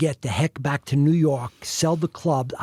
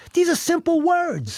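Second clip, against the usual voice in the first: very high, incredulous protest; exasperated protest; 12 semitones higher, slower than usual. Retold or imitated. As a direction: plead the obvious, voice shooting up. Retells protesting the script to Tom.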